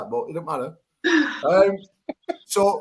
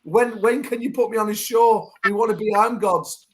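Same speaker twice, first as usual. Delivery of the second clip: higher, mocking voice of the women pleading; mock women's messages; 7 semitones higher than usual. Host mocking women begging to join his show, higher mock plea. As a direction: put on a pleading fan's voice. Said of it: "I've had a hundred messages off the women".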